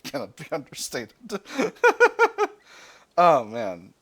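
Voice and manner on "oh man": big laugh. Real, not acted. Laughs at British understatement.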